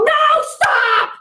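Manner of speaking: high loud shout of warning; urgent shout. Shown as a demonstration. Stopping a kid running into the street, an urgent high shout. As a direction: yell it to stop a child. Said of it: "You have to go".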